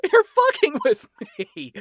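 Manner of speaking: squealing high with laughter. A narrator's reading. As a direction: pitch it way up, still laughing. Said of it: "burst into laughter and doubled over".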